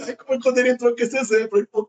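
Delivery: normal talking voice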